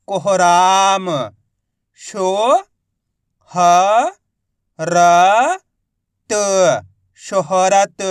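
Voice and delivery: कोहराम very slowly, then शो, ह, र, त apart; syllable by syllable. Shown as a demonstration. Teacher demonstrating reading, कोहराम and शोहरत drawn out. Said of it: "direct मात्रा का उच्चारण करके".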